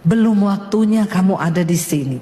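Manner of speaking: low, measured divine pronouncement; voice of God. Retold or imitated. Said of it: "Tuhan ngomong begini".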